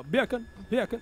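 "bacon" in a Jamaican accent, as "byah-kun"; Jamaican-style "bacon" with a y-glide after the b. Shown as a demonstration. Showing a friend how to fake Jamaican, Jamaican accent. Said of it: "say it with the accent".

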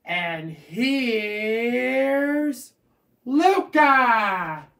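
announcer call. Pretend. Kid announcing talk show host.

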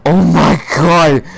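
gasping between drawn-out exclamations; real overwhelmed excitement, gulping air between phrases; breathless awe. Real, not acted.

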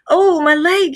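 high, loud yelp of hurt; cried out in pain. A narrator's reading. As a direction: cry out, pitched well up. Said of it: "he cried".

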